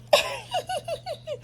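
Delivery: high giddy squeal of a laugh. Real, not acted. Reader squealing as the couple finally kiss. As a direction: let out a delighted squeal.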